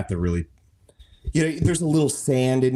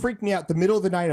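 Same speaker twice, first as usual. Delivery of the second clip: ordinary talk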